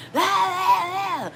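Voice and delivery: high-pitched mock parental yelling; private yelling mom. Mom alone with her children in private, acted angry yelling. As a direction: rant at the child in a high, drawn-out yell. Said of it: "And then in private, you're like".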